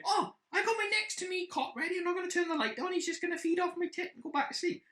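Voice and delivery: high, squeaky mimicry of partner; mocking partner's optimism. Man mocking partner's newborn plans, high mimicking voice. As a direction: put on a high, airy know-it-all voice. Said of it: "You think".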